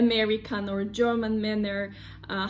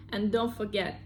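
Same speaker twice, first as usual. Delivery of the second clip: ordinary delivery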